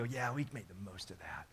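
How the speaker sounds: whispered, low and hushed; soft whisper. Retold or imitated. Remembering time well spent with his kids, whispered. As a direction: breathe it out like a private memory. Reflecting back on good moments.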